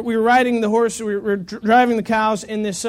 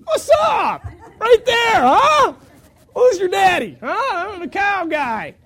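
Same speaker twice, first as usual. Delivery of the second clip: much higher, loud swaggering boast; cocky taunt; 9 semitones higher, 4 dB louder, slower than usual. Retold or imitated. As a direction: crow at the cow like a champ. Cocky taunt after cow jumps in.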